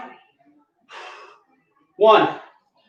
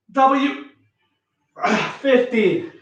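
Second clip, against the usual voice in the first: usual speaking voice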